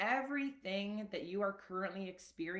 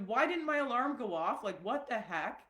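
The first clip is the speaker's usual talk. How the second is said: a hint of furious, higher and louder; mock angry outburst; 5 semitones higher, 5 dB louder than usual. Retelling her angry wake-up to viewers, mild mock rant. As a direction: replay the frantic waking complaint as a mild mock rant. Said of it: "I was so mad".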